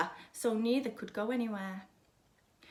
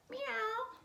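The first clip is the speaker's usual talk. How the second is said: high, soft pleased meow; kitten meow; 13 semitones higher, 5 dB quieter than usual. A narrator's reading. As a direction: mew like a happy kitten. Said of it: "The kitten meowed in pleasure".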